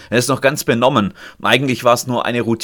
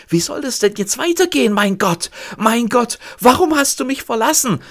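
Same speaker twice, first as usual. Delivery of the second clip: high, raised cry of a man who has just lost his job; a raised, pitched-up lament; desperate cry; 11 semitones higher than usual. Acted. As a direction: plead with God, shaken. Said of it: "Er schreit es".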